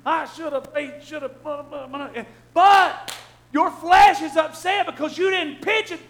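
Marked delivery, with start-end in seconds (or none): 0.1-2.2 muttered regret. Retold or imitated. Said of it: "you're like".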